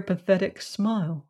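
plain reading voice

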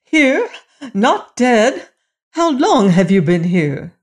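high, loud, shocked questioning; frightened cry. A narrator's reading. Queen to the stepson she thought dead, frightened. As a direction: start very high in alarm, stay loud. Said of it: "shaking with fright".

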